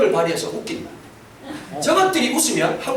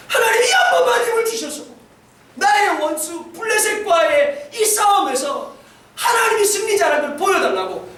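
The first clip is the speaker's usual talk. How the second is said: Samson's desperate prayer; 7 semitones higher than usual. Retold or imitated. Samson crying out to God for strength, pleading shout. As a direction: beg God for one last strength, near tears.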